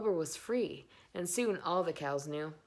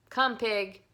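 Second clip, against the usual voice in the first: calling the pig; 5 semitones higher, 9 dB louder than usual. A narrator's reading.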